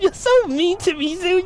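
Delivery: high, hammed-up hurt complaint; playful sulky whine; mock whine. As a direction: pretend to be wounded.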